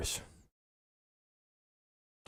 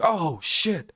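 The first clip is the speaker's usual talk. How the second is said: mock startled; 7 semitones higher than usual. Imagined himself dying.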